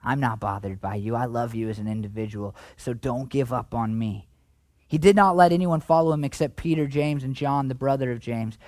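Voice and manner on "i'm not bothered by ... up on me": a hint of Jesus comforting the woman; gentle Jesus. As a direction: lower and softer, reassuring. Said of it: "He's like".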